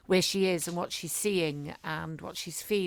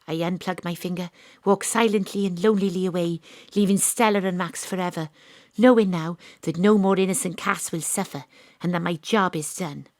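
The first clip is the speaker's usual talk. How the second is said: louder, first-person detective narration; in-character drama narration; 10 dB louder than usual. Acted. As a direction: play Astrid walking away from the case. Astrid drama scene, acted narration.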